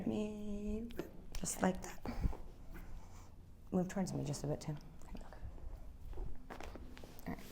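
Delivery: soft whispered guidance; whispering. Real, not acted. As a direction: whisper the instructions close up. Adjuster quietly guiding patient.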